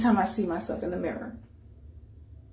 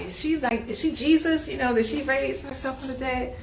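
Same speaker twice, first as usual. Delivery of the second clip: higher, incredulous quoted viewers; mock disbelief; 6 semitones higher than usual. Viewers asking if she rose from the dead, incredulous. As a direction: sound like a doubter who can't believe it. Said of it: "y'all like".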